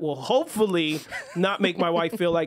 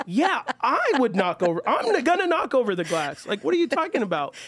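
higher-pitched, incredulous mock protest; exasperated retort; 6 semitones higher than usual. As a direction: act out baffled exasperation at your wife. Retells his exasperated reply to wife.

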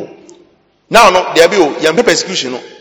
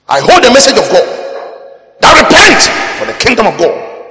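loud, high prophetic shout; crying out; 6 semitones higher, 5 dB louder than usual. Real, not acted. Preacher delivering his message to the congregation, crying out loud. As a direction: proclaim it like a herald.

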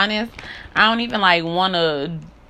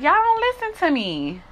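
retold complaint; 10 semitones higher than usual. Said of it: "'Cause I be like".

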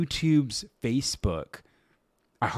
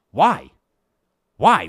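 incredulous why; 7 dB louder than usual. Real, not acted.

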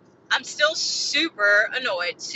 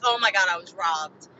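mock dramatic; 5 semitones lower than usual. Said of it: "saying that sounds so dramatic".

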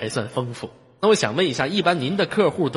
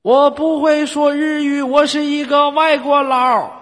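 high, slow broken foreign Chinese; foreigner accent; 11 semitones higher, 5 dB louder, slower than usual. Pretend. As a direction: put on a clumsy foreigner's Chinese.

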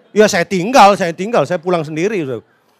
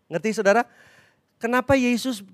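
ordinary talk

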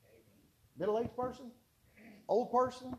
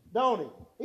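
ordinary talk

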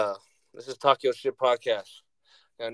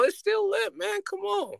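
much higher, excited cry; hyped up. Real, not acted. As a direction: hype it up high. Hyping the music.

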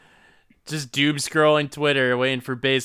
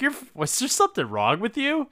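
high, quick baffled question; mock bewildered; 11 semitones higher, faster than usual. Co-host as townsfolk questioning the detective, mock bewildered. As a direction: act a puzzled onlooker.

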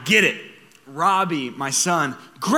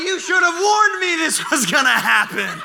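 high, louder, laughing mock-whiny kid; indignant toddler; 11 semitones higher, 6 dB louder than usual. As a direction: play the offended little boy, laughing.

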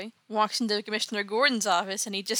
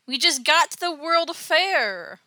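higher, louder, stretched-out complaint; whiny protest; 7 semitones higher, 5 dB louder, slower than usual. Acted. Narrator sides with Dick about the fair, whiny protest. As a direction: echo the sidekick's whine in full agreement. Teller echoes Dick's complaint in agreement.

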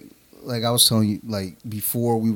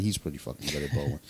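ordinary talk, nothing marked

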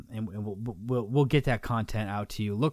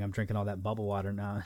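usual speaking voice

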